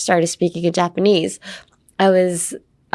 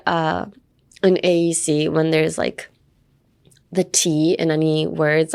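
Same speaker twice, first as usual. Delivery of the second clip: usual speaking voice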